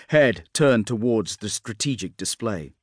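ordinary delivery

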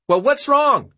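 very high, loud shout; yelling back. A narrator's reading. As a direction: yell back from the crowd. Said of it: "someone yelled back".

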